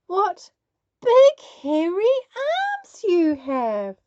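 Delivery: slow, cautious and high; carefully. Acted. Little Red wary of the wolf, careful and slow. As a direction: tread lightly on each word. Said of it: "Little Red said carefully".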